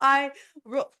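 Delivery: choking on a drink; sputter, something went down wrong. Real, not acted. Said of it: "I went down the wrong pipe".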